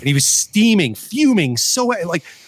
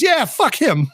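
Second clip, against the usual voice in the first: put-on old neighbor agreeing; 12 semitones higher, slower than usual. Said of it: "He goes".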